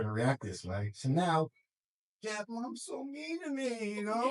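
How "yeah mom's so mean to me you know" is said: put-on wheedling kid voice.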